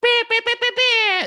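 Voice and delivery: piercing high imitated air-horn beeps; rapid high beeps mimicking a DJ effect; DJ sound effect. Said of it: "F X sound".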